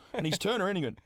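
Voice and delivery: ordinary delivery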